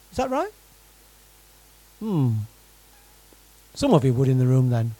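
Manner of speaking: ordinary delivery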